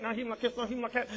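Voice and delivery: ordinary talk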